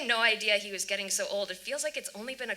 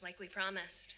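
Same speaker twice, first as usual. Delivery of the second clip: quiet; 11 dB quieter than usual. Acted. Hushed talk of secret.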